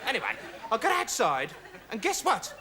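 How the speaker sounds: ordinary talk, nothing marked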